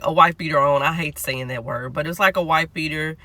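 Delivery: usual speaking voice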